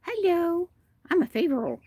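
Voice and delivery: high little chicken voice; voicing the hen. Keeper speaking for the nameless Favorall, high-pitched. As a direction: speak as a small sweet hen. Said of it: "She's saying".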